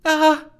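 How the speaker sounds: high, loud gurgled "ah"; sudden high gurgling exclamation; nervous gurgle. Acted. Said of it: "she gurgled".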